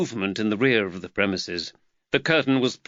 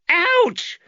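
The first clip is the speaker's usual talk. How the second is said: very high, loud yelp of pain; boy yelps after a cuff; squeal; 6 dB louder than usual. Acted. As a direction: squeal as your ear is cuffed. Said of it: "Nathaniel squealed".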